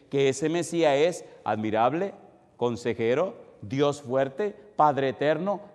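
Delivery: ordinary delivery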